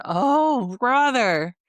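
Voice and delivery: high, loud, long-drawn words sliding down; exasperated groan. Retold or imitated. Viewer reacting to a gushing contestant, exasperated. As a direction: sound thoroughly fed up.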